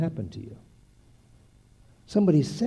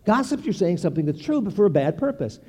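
ordinary delivery

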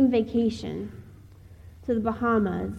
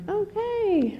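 drawn-out, much higher-pitched okay; taken aback; 12 semitones higher than usual. Retold or imitated. Speaker reacting to the kid's yelled remark, taken aback. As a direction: react to an odd remark with a high, stretched word. Her retold reaction to the heckle.

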